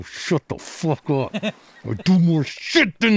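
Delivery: hammed-up anger at fans; mock angry retort. Snapping at fans who call him lemon guy, mock furious.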